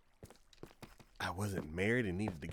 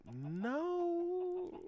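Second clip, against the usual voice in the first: mock despair. Car battery dead, she protests.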